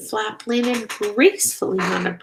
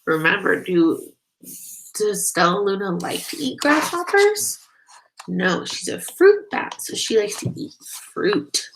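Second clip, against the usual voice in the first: ordinary delivery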